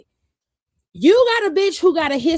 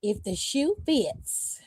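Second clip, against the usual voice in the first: slow, soft, lower imitation of mama; mama's saying; 4 semitones lower, 9 dB quieter, much slower than usual. Quoting her mama's saying slowly and softly, mama's voice. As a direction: drawl it slowly like mama would. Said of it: "as my mama would say".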